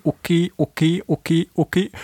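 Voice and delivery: owl bark mimicked as okay okay; barking owl call. Shown as a demonstration. Reading the owl call aloud, mimicked bark. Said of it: "die etwa wie okay".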